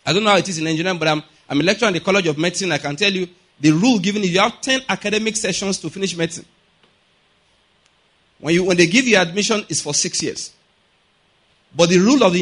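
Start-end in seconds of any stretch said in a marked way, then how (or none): none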